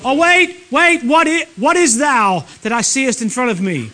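high, loud mock amazement; mock bewildered runner. Runner stopping at the finish line, in hammed-up bewilderment. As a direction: ham up a baffled runner in old English. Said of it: "You didn't go".

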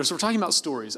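usual speaking voice

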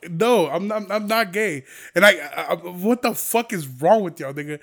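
ordinary talk

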